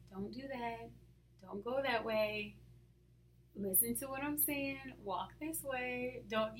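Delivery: very soft, gentle guidance; calm whispering. Acted. God quietly steering his daughter, calm hushed voice. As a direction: guide calmly in a hushed voice. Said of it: "he's very calm, whispers".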